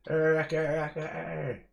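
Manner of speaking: high, loud, hyped hello; excited loud greeting. Real, not acted. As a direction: shout a hype welcome to a mate. Hyped reply to chat.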